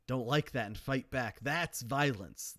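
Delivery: ordinary delivery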